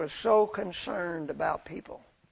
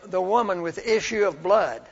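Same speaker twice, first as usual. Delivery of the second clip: ordinary delivery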